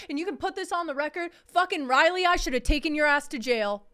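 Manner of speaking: loud, high furious call-out; shouting. Real, not acted. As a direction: yell it at the ex. Yelling at ex on record.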